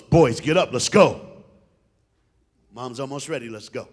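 low, loud commanding father; dad rousing boys. Retold or imitated. Dad ordering the boys out of bed, booming low. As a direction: boom it out to sleeping kids.

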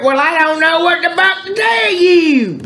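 high shouted rant; high yelling. Acted. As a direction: yell it high at them. Calling out at family.

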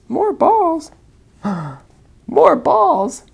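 high loud thrilled echo; excited. Acted. Elephant echoing the snake's idea with delight. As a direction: gasp it out with delight.

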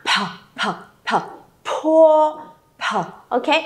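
"Pờ" breathed out hard; aspirated initial drill. Shown as a demonstration. Teacher stressing aspiration to the class, breathy and clear. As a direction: push air on each "pờ". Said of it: "âm bật hơi này".

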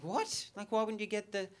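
high-pitched disbelief, pressing for a reason; can't believe what the mate just said; incredulous. Retold or imitated. Mate refuses fake testicle on phone.

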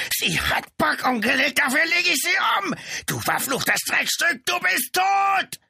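high, raging scream; screamed threat. A narrator's reading. Brother threatens the woman past the policeman, screaming. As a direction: scream murderously past the man in front. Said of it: "schrie er an Brown vorbei".

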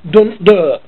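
"Dunt, duh" clipped in broad Norfolk for don't do it. Shown as a demonstration. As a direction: drop the vowels, broad Norfolk style. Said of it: "which would translate to "don't do it".

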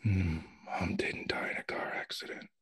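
hushed conspiratorial whisper. Acted. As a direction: whisper a secret to your son. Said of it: "Eugene whispering".

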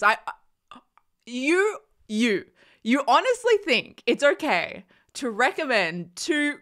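ordinary delivery

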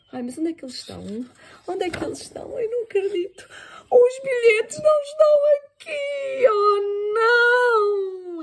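from worried search to high, loud dismay; panic. Acted. Mother can't find tickets.

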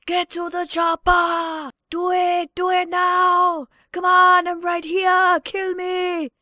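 Schwarzenegger impression. Action hero yelling at the enemy, loud bellow. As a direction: bark orders like an action hero. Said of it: "some of these impressions".

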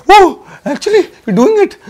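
ordinary talk

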